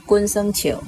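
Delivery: ordinary delivery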